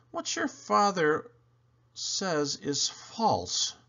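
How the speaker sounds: lightly voiced mom at dinner. A narrator's reading. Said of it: "Mom says".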